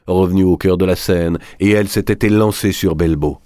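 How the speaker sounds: ordinary delivery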